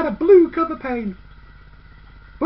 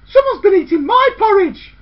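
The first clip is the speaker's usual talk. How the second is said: indignant middle sister; 4 semitones higher, 8 dB louder than usual. A narrator's reading. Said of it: "The middlest girl said".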